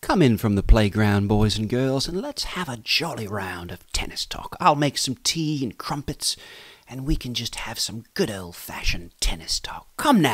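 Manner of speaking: a put-on jolly English host inviting everyone to tea; mock British host. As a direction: play a cheery old-fashioned British presenter.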